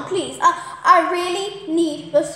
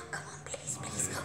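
whispered pleading; 19 dB quieter than usual. Real, not acted. Begging fish to bite.

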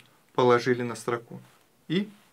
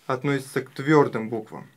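ordinary delivery

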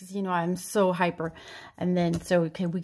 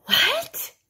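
put-on much higher, puzzled old dad's "What?"; hard-of-hearing dad. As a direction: play the deaf old father straining to hear. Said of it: "This is my dad".

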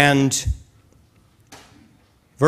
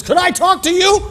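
woman's voice; 4 dB louder than usual. Retold or imitated.

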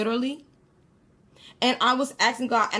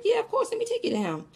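much higher, quick and breezy; nonchalant; 13 semitones higher, faster than usual. Retold or imitated. Dreamer agreeing to take the man to the boy, nonchalant. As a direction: airily toss off an imitated yes. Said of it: "it was, like, nonchalant".

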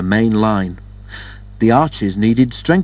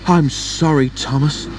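higher, lightly acted breathlessness; a touch out of breath; breathless puffing; 4 semitones higher than usual. A narrator's reading. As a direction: apologise as if just arrived puffing. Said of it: "he puffed as he came breathlessly".

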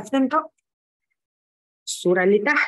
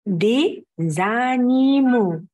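slow model word; slower than usual. Shown as a demonstration. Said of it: "كلنا نقول ورا بعض كده".

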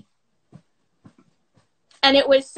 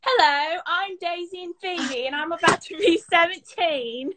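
higher-pitched mock British accent; British impersonation; 4 semitones higher than usual. Shown as a demonstration. American fan impersonating two British friends to their faces, mock British accent. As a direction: put on a playful British accent. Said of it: "You wanna hear my impersonation of you guys?".

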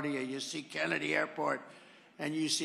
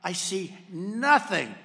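usual speaking voice

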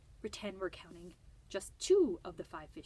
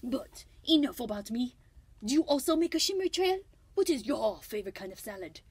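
louder bad French accent, grandly offended; Escargot; 4 semitones higher, 8 dB louder than usual. French snail defending his trails against being called slimy, very bad French accent. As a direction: protest with flourish in a hammed-up French accent. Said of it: "a very, very bad French accent".

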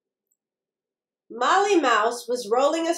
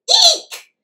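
very high, loud little squeal; sudden high-pitched eek; mouse squeak; 10 dB louder than usual. A narrator's reading. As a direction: squeak like startled mice. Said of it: "squeaked the mice".

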